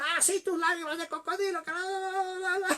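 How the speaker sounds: very high, sneering imitation; mock scorn. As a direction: imitate a husband dismissing his wife's tears. Pastor acted scorning wife's tears. (burla despectiva y muy aguda, actuada)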